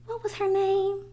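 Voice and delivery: put-on high, light child's voice, wondering aloud; a young boy musing to himself; pondering child. A narrator's reading. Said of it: "Stamatis wondered".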